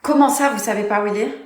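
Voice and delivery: normal talking voice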